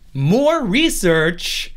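drawn out very slowly; catchphrase. Acted. Host leads listeners in a running catchphrase, slowly. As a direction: stretch it out like a chant. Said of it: "say it with me".

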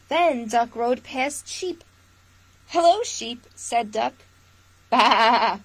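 lively storytime read-aloud; picture-book voice for little listeners; reading to children. Acted. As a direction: read it warmly to a room of kids. Reads picture book to viewers.

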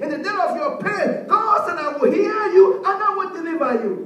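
rhythmic scripture proclamation, rising in pitch; building preaching cadence. Acted. Preacher quoting God's promise to the troubled, building preaching cadence. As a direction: build each phrase towards the promise.

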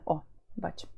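whispered aside «О, бачу». Real, not acted. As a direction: mutter «О, бачу» to yourself while fixing the screen. Aside while adjusting screen. (пошепки, сам до себе)

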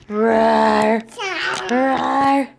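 mock monster growl for a toddler; hammed-up roar to chase the kid; playful monster roar. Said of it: "I'm gonna get you".

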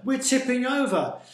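high, loud alarmed shout; crying out. A narrator's reading. Sailor on a tipping boat, crying out. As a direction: yell that disaster is coming. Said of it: "cried another".

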